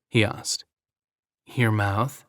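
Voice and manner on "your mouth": understated come-on, lightly voiced; deliberately seductive. A narrator's reading. Gwen answering Jack's question, mildly seductive. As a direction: let it linger, a mild tease. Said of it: "in a deliberately seductive tone".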